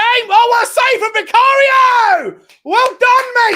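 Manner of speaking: real high, drawn-out celebratory roar; elated shout. Real, not acted. Fan hailing Vicario's save, elated shout. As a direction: yell it high and loud, stretching the words.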